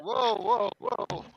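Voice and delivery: shocked whoa. Real, not acted. Shocked reaction.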